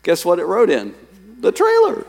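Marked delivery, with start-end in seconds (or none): 1.4-2.0 excited exclamation. Real, not acted. Punchline of answered-prayer story.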